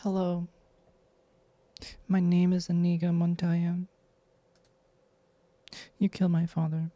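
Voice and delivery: warm femme sultry read. Acted. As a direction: purr it, warm and seductive. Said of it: "You have a warm, femme, sultry voice".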